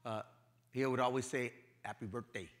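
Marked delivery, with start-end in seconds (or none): 1.8-2.5 put-on light Spanish accent, quietly spoken; father's Spanish accent. Father phoning his son on his birthday, light Spanish accent. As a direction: imitate dad's soft Spanish-accented English. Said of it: "in his accent".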